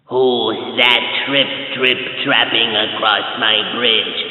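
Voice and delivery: low, slow troll growl; slow and low like a troll under a bridge; deep troll voice. A narrator's reading. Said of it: "A deep voice came".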